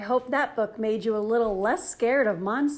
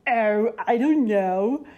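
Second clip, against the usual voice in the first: slow, louder mock-menacing monster; trying to sound scary; 7 dB louder, slower than usual. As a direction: fake spooky, not quite pulling it off. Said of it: "trying to sound terrifying".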